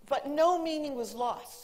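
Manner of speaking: usual speaking voice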